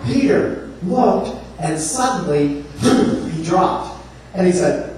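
ordinary talk

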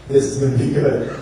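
amused chuckle. Acted. Jesus's amused private thought.

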